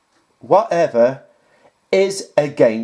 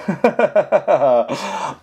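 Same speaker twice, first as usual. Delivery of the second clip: laugh; 3 semitones higher than usual. Real, not acted. About to mock a video.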